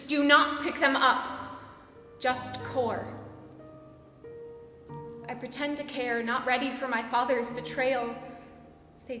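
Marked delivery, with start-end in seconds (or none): 0.1-3.1 loud, emphatic command; acted parental command; stern order. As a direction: order it forcefully, as a mother mid-task. Mother's order voiced in poem.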